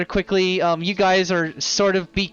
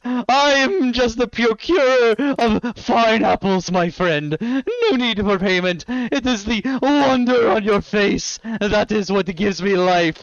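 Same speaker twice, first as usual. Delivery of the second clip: put-on high, slow, theatrical old man; grandly gracious old eccentric; spry old man; 5 semitones higher than usual. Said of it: "clicks his heels together and goes".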